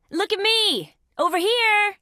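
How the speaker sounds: high taunting call for attention; high-pitched singling-yourself-out call. Acted. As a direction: draw their fire from afar. Drawing enemy fire with taunts.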